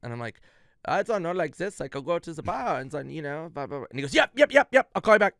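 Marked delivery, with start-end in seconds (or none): 0.8-3.9 put-on improvised German accent, pitched up; German-accented rambling, trailing into blahs. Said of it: "like, a German accent". 4.1-5.3 loud, high rapid-fire yeps; imitating an excited, hurried caller; abrupt excited yelping. Imitates Sandler cutting the call short.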